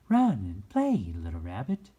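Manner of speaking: fairy urging the little rabbit to play. A narrator's reading. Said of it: "she said".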